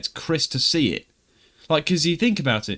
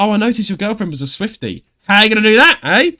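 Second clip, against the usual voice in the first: teasing friend voice, higher and louder; mocking Beth; 7 semitones higher, 8 dB louder than usual. Friend Beth teasing him about his girlfriend, higher mocking voice. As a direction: needle him like a smug mate. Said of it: "she was like".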